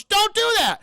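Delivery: high, loud warning; hammed-up panicked protest; mock alarmed shout. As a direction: yell it in comic alarm. Alarmed reaction to dagger grab.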